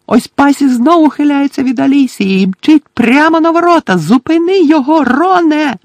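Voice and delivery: very high, loud play-by-play; frantic commentator. Acted. Commentator begs the keeper to stop the shot, frantic. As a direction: cry out, urging the save.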